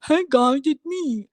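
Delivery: high, put-on dimwitted fan voice; mocking a sub. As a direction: parody a clueless commenter with a self-important tone. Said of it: "borderline illiterate sub, like".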